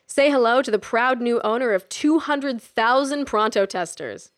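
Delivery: slow, loud and smug. Acted. Jack smugly revealing his huge purchase to Kelly, slow and loud. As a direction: savour the big reveal. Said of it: "Jack said smugly".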